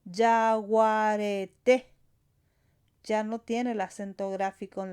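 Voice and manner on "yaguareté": "Yaguareté" spelled out slowly, with the stress landing on the final té; slow teacher demo of "Yaguareté", stress on té. Shown as a demonstration. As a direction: draw out "Yaguareté" syllable by syllable and hit the last one. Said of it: "pero sí debemos pronunciarlo".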